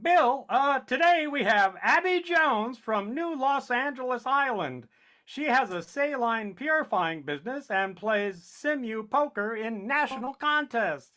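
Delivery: put-on old speech synthesizer. Announcer voice, synthetic timbre.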